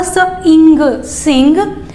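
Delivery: "स, इंग" drawn out slowly, then joined into "सिंग"; sound blending. Shown as a demonstration. Teacher sounding out sing for kids. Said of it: "तो क्या हुआ?".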